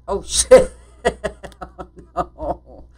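loud laughing "oh no"; real laughter bursting through the words; laughing dismay. Real, not acted. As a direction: crack up loudly at your own blunder. Realizes mistake mid-game, laughing.

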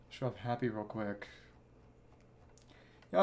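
usual speaking voice

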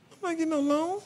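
high, soft disbelieving scoff. Retold or imitated. Telling the salesman no loan, high and scoffing. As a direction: squeak it out like that's absurd. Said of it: "I said".

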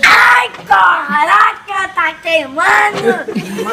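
rosto franzido de azedume; sour candy. Real, not acted. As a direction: gritando com a boca ardendo. Repetição da reação ao limão. (grito de azedo)

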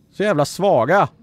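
high, loud scolding; frustrated shout. Real, not acted. Gamer scolds helpers who cannot reach, frustrated shout.

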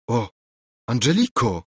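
ordinary delivery, nothing marked

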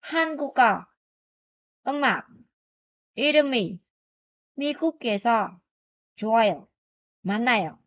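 clear, careful Korean read for students; Korean model reading. Shown as a demonstration. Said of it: "mình đọc một lần".

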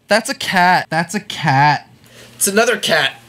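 high, proud show-off voice listing things; mock bragging. Parodying a musician vlogger, bragging high and smug. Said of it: "Now I'm gonna brag about how awesome I am".

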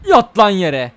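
loud rough shout; shouting at the game opponent. Real, not acted. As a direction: bark it at the opponent.

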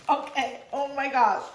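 normal talking voice

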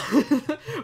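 high, loud startled laugh; real laugh of disbelief breaking out; burst of laughter. Real, not acted. Streamer laughing as the fire chaos unfolds, loud laugh.

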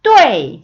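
뚜에이 as one loud syllable sliding sharply down; falling fourth tone. Shown as a demonstration. Teacher demonstrating fourth tone, 뚜에이 loud and falling. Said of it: "성조 한번 연습하고".

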